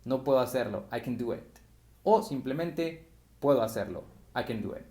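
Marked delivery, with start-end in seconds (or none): 0.9-1.6 "can" said as "kn" in fast speech; fast reduced can. Shown as a demonstration. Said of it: "hablando rápido suenan como kn". 4.3-4.8 "can" said as "kn" in fast speech; fast reduced can. Shown as a demonstration. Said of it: "hablando rápido suenan como kn".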